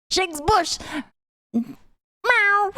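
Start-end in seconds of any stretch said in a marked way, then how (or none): 1.5-2.7 high, cute meow; playful kitty meow; cat meow. Pretend. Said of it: "I am the bush kitty".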